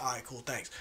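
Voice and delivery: very soft, low, casual thanks; calm and collected. Retold or imitated. Friend paying at the drive-thru window, calm and collected. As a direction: toss off a quiet, unbothered thanks. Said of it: "all, all calm and collected".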